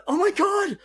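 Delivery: very high, loud squeal of recognition; hammed-up high-pitched fan outburst; mock-horrified realisation. Acting out own starstruck realisation.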